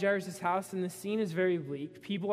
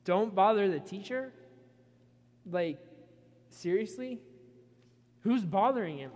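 louder, rising in disbelief on bother; incredulous question; 3 dB louder than usual. Shown as a demonstration. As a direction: throw the words back in disbelief. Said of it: "what am I asking myself?".

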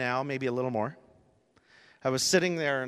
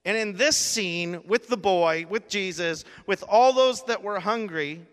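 raised, louder preaching push; preaching climax; 7 semitones higher, 5 dB louder than usual. Acted. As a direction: build the sermon to its peak. Preaching climax at full force.

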